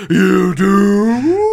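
lightly voiced horror vampire; a hint of an old horror vampire. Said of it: "like, vampire voice".

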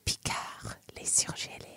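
soft breathy French whisper. Shown as a demonstration. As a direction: whisper it like a secret. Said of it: "I have to whisper it".

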